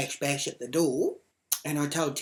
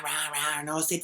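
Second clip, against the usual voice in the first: normal talking voice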